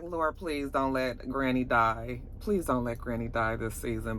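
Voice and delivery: quiet begging prayer; pleading prayer. Retold or imitated. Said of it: "I was just like".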